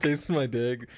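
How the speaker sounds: usual speaking voice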